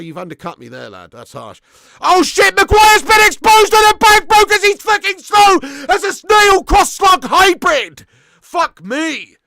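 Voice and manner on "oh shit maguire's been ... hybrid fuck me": loud, high angry yell at a defender; furious shout. Real, not acted. Fan yelling at Maguire for being too slow, furious shout. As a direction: bellow it at the screen in disbelief.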